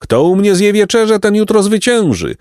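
high hawker's call. A narrator's reading. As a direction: lure diners in with a high cry. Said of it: "wołał trzeci".

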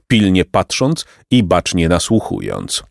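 plain reading voice

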